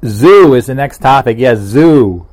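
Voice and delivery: loud, punchy reveal; excited announcement. Real, not acted. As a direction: announce it like big news.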